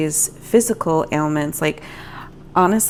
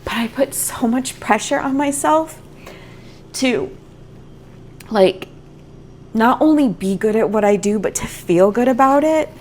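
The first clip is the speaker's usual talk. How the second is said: ordinary delivery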